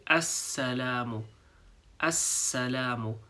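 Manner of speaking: Assalamu with the S held a moment, slowly. Shown as a demonstration. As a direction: linger briefly on the S of Assalamu. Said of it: "held on the S for a tiny second".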